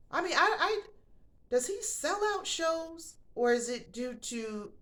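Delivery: high, disbelieving, slowed; incredulous. Real, not acted. Ranting about a rapper's sold-out shows, high incredulous voice.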